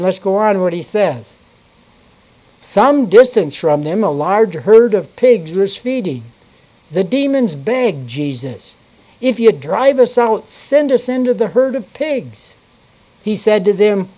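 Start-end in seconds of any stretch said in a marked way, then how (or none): 2.6-14.1 measured King James reading voice; steady pulpit reading; scripture reading. Acted. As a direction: read the Bible passage to the congregation. Reads scripture aloud in church.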